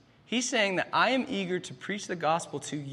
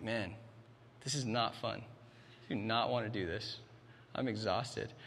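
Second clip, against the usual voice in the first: tired grumble; 7 semitones lower, 9 dB quieter than usual. Retold or imitated. Tired dad at 2 a.m.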